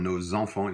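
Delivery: "nos enfants" linked with a z: "no-zenfants"; liaison nos enfants. Shown as a demonstration. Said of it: "you hear that little liaison".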